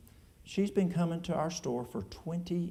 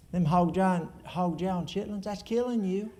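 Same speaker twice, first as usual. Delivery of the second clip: louder, higher mock warning; emphatic acted telling-off; blunt scolding; 5 semitones higher, 4 dB louder than usual. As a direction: lay down the law about their food, pitched up. Acting out blunt advice to someone.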